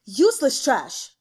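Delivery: loud, slow contemptuous snap; slow furious snap; spat in anger. A narrator's reading. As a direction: spit the words out at a useless servant. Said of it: "the emperor spat".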